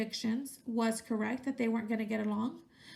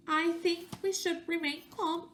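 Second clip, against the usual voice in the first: plain reading voice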